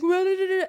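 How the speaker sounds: high, loud burst of nonsense syllables; panicked babble. Retold or imitated. Choker's mind while the announcement goes on, panicked. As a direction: imitate a frantic mind jabbering. Mimics frantic racing thoughts.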